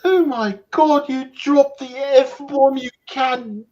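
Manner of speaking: high, slow, loud scandalised British voice; shocked British prude scolding a swearer; mock British accent. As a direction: gasp in British outrage, dragged out. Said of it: "I'm acting".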